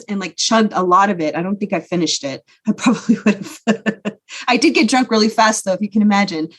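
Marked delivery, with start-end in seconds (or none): 2.7-4.2 light laugh through words. Real, not acted. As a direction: chuckle at her own story. Laughs at herself.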